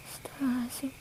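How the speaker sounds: breathless whispered astagfirullah; whispered prayer. Real, not acted. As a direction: gasp it under your breath. Shocked at the story. (bisikan istighfar kaget)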